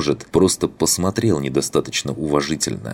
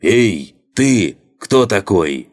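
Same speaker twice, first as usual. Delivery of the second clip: loud call, pitched up high; shouting; 5 semitones higher, 5 dB louder, much slower than usual. A narrator's reading. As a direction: yell it out across the deck. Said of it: "озвучил мой вопрос во весь голос". (во весь голос)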